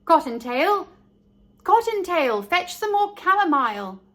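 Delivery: high, loud call from inside the burrow. A narrator's reading. Mother rabbit calling her daughter from the burrow, loud and high. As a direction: call the child home loudly and high. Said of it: "voice was heard inside the rabbit hole, calling".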